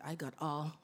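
soft whispered aside; silent inner voice. Retold or imitated. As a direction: keep it under your breath. Silently holding out in the deal.

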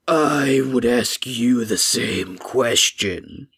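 low, slow golem voice; flesh golem voice. Acted. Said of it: "And he says".

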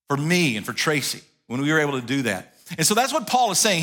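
ordinary talk

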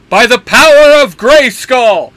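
slow, high, loud heroic battle cry; yelling. Acted. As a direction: bellow it like He-Man. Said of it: "Dean yells out".

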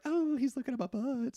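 high, soft imitated thrill; secret delight. Woman walking away notices him looking, secret delight. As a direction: act out a private little squeal of pleasure.

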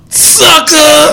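Booker T catchphrase. Retold or imitated. Co-host imitating Booker T's catchphrase, shouted with a laugh. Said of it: "Hey, Booker T, what's going on?".

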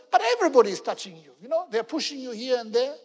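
fast, loud, protesting; hurried disciples pushing back; exasperated disciples. Acted. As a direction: rush it out like an obvious objection.

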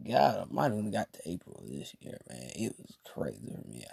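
low, fast under-the-breath grumble; muttered aside, barely audible; muttering to himself. Retold or imitated. Sitting there, muttering to himself about April. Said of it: "And I was sitting there like".

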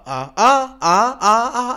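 loud hammed-up fake sobs; overdone mock sobbing. Mock crying.

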